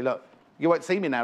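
usual speaking voice

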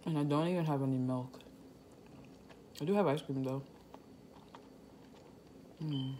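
chewing noodles; talking between bites; mouth full. Real, not acted. Seen 4: Noodles held at lips; cheeks and lips show chewing while talking.

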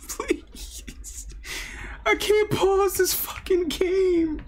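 very high, panicked pleading; panicked whine. Real, not acted. Scared player who cannot pause, high panicked whine. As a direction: beg in terror, stretched out slowly.

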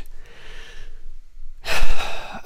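long, heavy sigh; loud sigh. A narrator's reading. Kay sighs at Osax before speaking, a heavy audible sigh. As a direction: breathe out a big weary sigh. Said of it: "She sighed".

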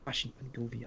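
very quiet, low murmur; hushed echo. Pretend. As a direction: play along, barely audible. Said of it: "é pra falar ba-, pra baixinho, baixinho". (baixinho, quase sussurrando)

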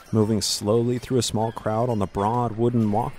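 ordinary delivery

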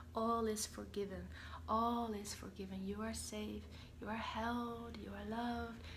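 whispered reassurance; tender whisper. Acted. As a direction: comfort them gently. Soothing mankind as the energy.

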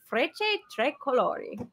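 Frecce Tricolori in a self-mocking, hammed-up Italian accent; bad Italian accent. Tour guide naming the Italian jet team for viewers, in a jokey Italian accent. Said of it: "My bad Italian accent".

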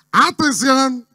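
loud, high sportscaster's alert; Mariano Clos. Acted. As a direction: cut in with an urgent commentator's call. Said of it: "a cada rato dice". (relator deportivo, fuerte y agudo)

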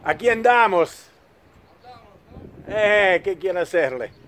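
playful mock Spanish; Spanish accent. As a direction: banter in fake Spanish. (con accento spagnolo scherzoso)

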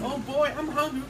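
ordinary delivery

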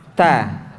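"Ta" with a hiss of breath on the t; breathy hissed ta. Shown as a demonstration. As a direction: let air hiss out on "Ta". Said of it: "nafasnya harus mendesis".

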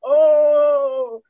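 loud, high sobbing cries; mock crying wail. A narrator's reading. Girl frightened at meeting the devil: loud sobbing cries. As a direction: wail in fright like a small child. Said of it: "she started crying".

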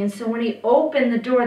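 ordinary delivery, nothing marked

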